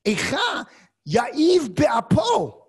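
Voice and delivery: accusing God. Acted. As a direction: demand it of God, voice climbing. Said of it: "a finger pointed directly at God and saying".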